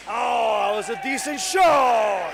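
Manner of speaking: loud, rising roar at a big shot; thrilled, full-voiced exclamation; excited commentator's shout. Real, not acted. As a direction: burst out over live play, stretching the last word.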